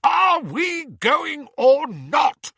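high, slow, loud bellow; roaring. A narrator's reading. Uncle Vernon demanding that they leave, roaring. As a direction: roar it impatiently, drawing out each word. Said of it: "roared Uncle Vernon".

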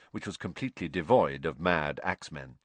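plain reading voice

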